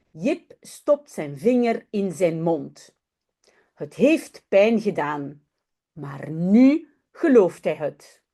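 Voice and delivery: ordinary talk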